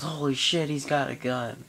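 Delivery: high, loud mock panic at the gun; imitating panicked friend. Friend Chris spotting the gun, imitated panicked yell. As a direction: yelp it out like you just saw a gun.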